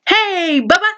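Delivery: put-on high child's call, laughing through it; toddler shouting. Toddler calling out to dad, high playful shout. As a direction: call out like a little kid across the store. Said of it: "shouts out".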